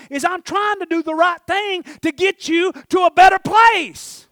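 loud shouted prayer building to long, high cries; full-force preaching. Real, not acted. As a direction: preach it at the top of your lungs, stretching the key words.

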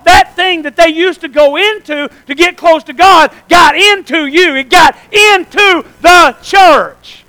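shouted, high-pitched preaching with stretched key words. Real, not acted. Preacher tells congregation the holiest dwells in them, shouted proclamation. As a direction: proclaim it at full force.

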